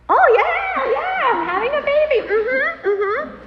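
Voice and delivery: very high, loud exclamation; hammed-up delighted remembering; sudden realization. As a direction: burst out as it dawns on you. Sudden realisation she is pregnant.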